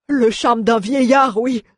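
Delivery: slow, high, sulky complaint; complaining dwarf. Acted. Mjoln complains to Aléa, sulky. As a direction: grumble it out, sorry for himself. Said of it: "se plaignit Mjoln".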